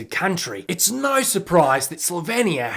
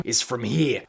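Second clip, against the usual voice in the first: ordinary talk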